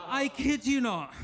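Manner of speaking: usual speaking voice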